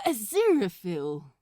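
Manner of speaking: overly proper, a bit rattled; flustered Aziraphale. Acted. Aziraphale on phone.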